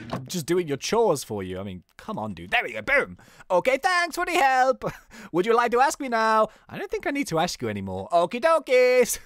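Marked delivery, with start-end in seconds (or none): 3.5-6.5 high, comic mock Mexican accent; bad Mexican accent. Rocky thanking the detective for help, bad Mexican accent. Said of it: "Or maybe, like, Mexican". 8.1-9.2 put-on high, slow comic Mexican accent; bad Mexican accent. Rocky signing off to the detective, silly Mexican accent. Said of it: "Or maybe, like, Mexican".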